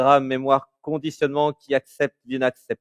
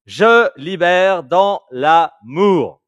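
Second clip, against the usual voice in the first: as loud as possible; 7 semitones higher than usual. Shown as a demonstration. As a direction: chant it out loud. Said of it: "le plus fort possible".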